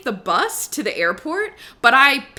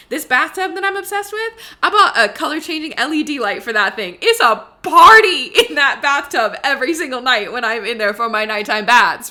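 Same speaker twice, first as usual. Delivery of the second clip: laughing excitedly; 3 semitones higher, 6 dB louder than usual. Real, not acted. Gushing about her LED bathtub.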